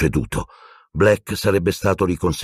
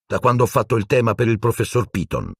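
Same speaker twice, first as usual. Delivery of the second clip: usual speaking voice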